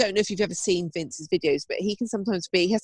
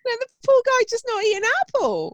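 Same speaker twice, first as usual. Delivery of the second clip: much higher and louder, pleading for the poor horse; mock exasperated plea; 4 dB louder than usual. Friend pleads for the fussy horse, high and loud. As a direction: squeal it out in playful outrage.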